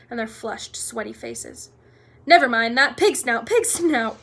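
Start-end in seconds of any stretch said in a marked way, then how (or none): 2.3-4.2 panting. A narrator's reading. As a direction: pant it out after running. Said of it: "panted Harry".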